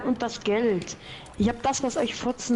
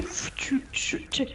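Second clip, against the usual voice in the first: whisper; 8 dB quieter, slower than usual. Pretend. Unvoiced repeated syllable.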